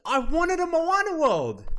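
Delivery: high loud mock outrage; mock angry. Real, not acted. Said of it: "oh, I'm so mad".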